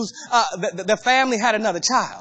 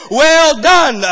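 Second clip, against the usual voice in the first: proud praise; 5 semitones higher, 7 dB louder than usual. Acted. God praises Abraham.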